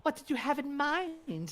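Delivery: high, slow shopkeeper pixie; lightly voiced undead pixie. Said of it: "says the pixie".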